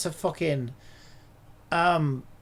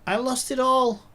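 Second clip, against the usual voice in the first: higher, raised-voice lament; dismayed outcry; 4 semitones higher, 4 dB louder than usual. Real, not acted. User laments lost work, high dismayed outcry. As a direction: cry out over the loss.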